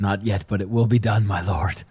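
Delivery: low, quiet sinister promise; menacing low pledge. Acted. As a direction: promise your dark lord quietly. Servant addressing his lord.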